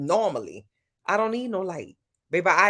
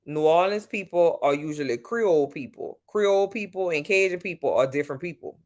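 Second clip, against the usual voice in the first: normal talking voice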